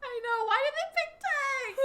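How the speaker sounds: very high, loud, laughing lament; laughing wail. Real, not acted. Laughing mock grief.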